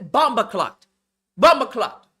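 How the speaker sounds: loud, punchy mock-Jamaican curse; hammed-up Jamaican patois outburst; put-on Jamaican accent. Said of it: "speak in the Jamaican language".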